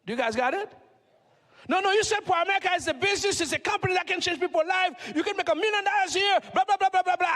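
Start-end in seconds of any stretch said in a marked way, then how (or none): 1.7-7.3 mock excited pitch. Speaker mimics a recruit's hype to the audience, mock excited. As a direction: parrot their big promises with a hammed-up rush. Mocking the prospect's sales pitch.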